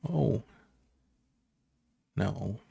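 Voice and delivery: ordinary delivery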